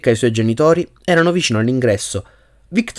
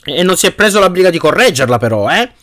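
raised, higher voice meant to be overheard; loud jibe; 6 semitones higher, 3 dB louder than usual. A narrator's reading. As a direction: say it loud enough for them to hear. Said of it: "abbastanza forte da farsi".

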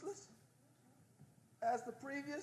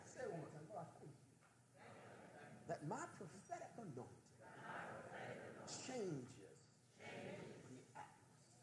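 hushed, much softer prayer leading; 2 semitones lower, 9 dB quieter than usual. Acted. Preacher leading the church in quiet prayer. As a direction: lead them softly, word by word.

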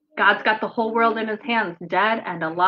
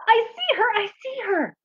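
put-on high, eager child mole voice; much higher, thrilled childlike mole; Little Mole crying out; 13 semitones higher than usual. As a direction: cry out like an excited little mole. Little Mole excited in story.

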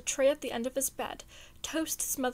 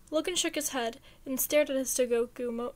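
ordinary delivery, nothing marked

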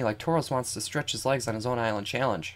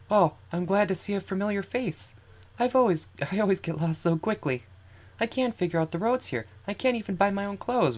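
put-on higher-pitched girl character voice; Lily, polite and helpless; 8 semitones higher than usual. Lily admitting she gets lost easily, helpless. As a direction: voice a game girl in a lifted pitch.

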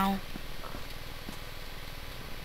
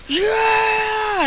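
excited cry; 12 semitones higher than usual. Retold or imitated. Gasps at seeing something exciting.

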